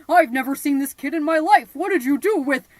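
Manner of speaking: loud, accusing shout; angry yelling. Acted. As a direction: hurl the accusation at her. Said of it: "and he says".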